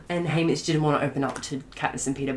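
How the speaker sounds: ordinary delivery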